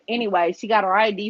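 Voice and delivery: usual speaking voice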